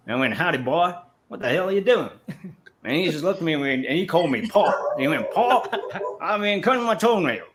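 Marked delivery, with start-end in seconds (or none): 0.3-2.1 mock Louisiana hillbilly calling to a friend; hillbilly Southern voice. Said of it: "we do a whole half hour of hillbilly talk". 5.3-7.5 much higher, hammed-up Southern twang; hillbilly Southern reply. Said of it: "we do a whole half hour of hillbilly talk".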